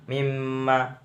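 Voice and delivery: "Mimma" with a long hummed double m; idgham bi ghunnah. Shown as a demonstration. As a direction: hold the nasal mm in "Mimma". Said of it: "bacaan idgham bi gunah". (baca "Mimma" dengan mim didengungkan)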